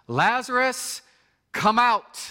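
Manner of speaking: high, loud commanding call; loud shout. Acted. Jesus calling into the tomb, loud commanding shout. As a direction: call him out of the grave at full voice. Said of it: "he shouts in a loud voice".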